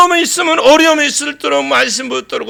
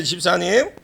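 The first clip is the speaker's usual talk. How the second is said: ordinary talk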